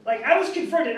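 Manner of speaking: ordinary talk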